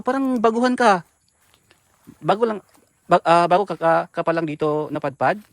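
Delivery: loud question to a stranger; calling out. Real, not acted. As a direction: raise the voice to reach her.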